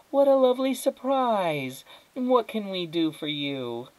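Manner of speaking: high, overly sweet hostess voice; gushing through a plastered-on smile; fake adult smile. A narrator's reading. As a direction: feign delight at an unexpected guest. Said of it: "with a big, fake adult smile".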